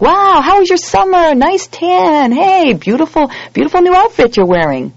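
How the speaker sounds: high, loud, slow gushing compliments; mock gushing small talk. Mimicking oblivious holiday chatter, high gushing small talk. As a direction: fawn over a friend's tan and outfit. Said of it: "It's just like".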